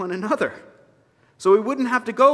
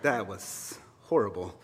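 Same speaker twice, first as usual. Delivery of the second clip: low, much softer, scornful ancestor grumble; disapproving ancestors; 11 semitones lower, 9 dB quieter than usual. Pretend. Preacher voicing ancestors scorning his Irish accent in a low grumble. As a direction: sound like unimpressed ghosts judging from above. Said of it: "the ancestors are looking down like".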